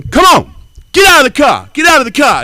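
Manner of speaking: high, loud, hammed-up challenge; mock yell. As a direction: shout her down like a road bully. Aggressive woman yelling.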